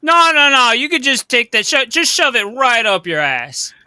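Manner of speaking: high, loud string of no's, then slowing; acted outburst, starting high and emphatic; indignant refusal. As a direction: brush them off with loud in-character scorn. Acted quarrel over the loot.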